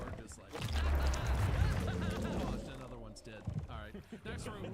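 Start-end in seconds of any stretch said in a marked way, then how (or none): 0.6-1.8 high unvoiced burst of laughter; real wheezing laugh out loud; cracking up hard, high and breathless. Real, not acted.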